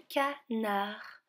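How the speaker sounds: "canard" split slowly into two syllables. Shown as a demonstration. Teacher shows children the odd word out, "canard" split syllable by syllable. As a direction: break "canard" slowly before its last syllable. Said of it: "finit avec la syllabe nar".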